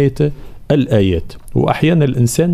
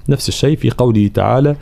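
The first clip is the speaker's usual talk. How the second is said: normal talking voice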